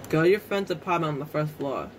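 ordinary talk

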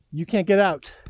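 ordinary talk, nothing marked

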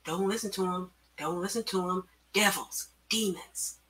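hushed, eerie whisper; soft insistent whispered warning. A narrator's reading. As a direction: whisper it like a voice in the head. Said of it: "whispering in her mind".

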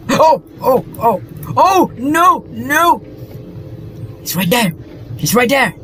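high terrified yelps; panicked screaming. Real, not acted. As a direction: shriek in real panic. Spots monster in horror game.

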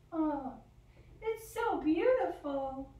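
high, slow, dreamy butterfly marvelling. Acted. Butterfly flying outside marvels at the beauty, high and slow. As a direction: float the words up like a delighted butterfly.

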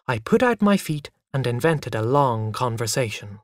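ordinary delivery

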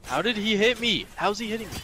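usual speaking voice

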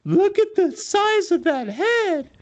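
high mock nurse, slow and astonished; imitating the nurse. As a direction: stretch it out high like the amazed nurse. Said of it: "And she goes".

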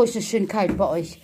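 ordinary talk, nothing marked

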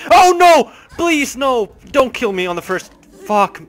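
high, loud cry easing into dismay; panicked shout. Real, not acted. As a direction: yell and beg as you get caught. Alien catches the player in game.

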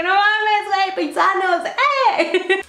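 high, excited cheer with laughter; super happy. Retold or imitated. Retelling meeting fellow Mexicans abroad, super happy. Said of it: "Estaba súper feliz porque dije".

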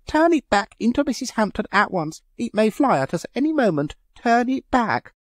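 high, panicky pleading orders; frantic command. A narrator's reading. Aunt ordering Leonard to undo the wolf, frantic. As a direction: beg him urgently to undo it. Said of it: "she screamed shrilly".

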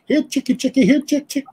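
high singsong chicken call. Retold or imitated. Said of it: "he'd go".

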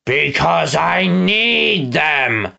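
slow, drawn-out villain's declaration; declaring villain. Acted. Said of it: "Snatcher declared".

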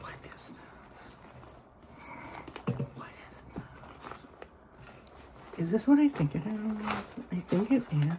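stunned barely audible whisper; awed whisper. Real, not acted. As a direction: breathe it in disbelief. Opening another gift.

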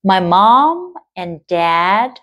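"My mom and dad" drawn out very slowly. Shown as a demonstration. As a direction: stretch every word. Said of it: "model reading". (đọc rất chậm)

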